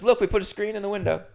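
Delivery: a hint of a too-eager little liar; eager landlady. Landlady points out the screen to the inspector, lightly voiced eagerness.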